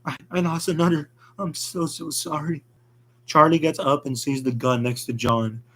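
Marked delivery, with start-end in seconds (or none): 0.0-2.6 1 crying for friend. A narrator's reading. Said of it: "She cries for her dead friend".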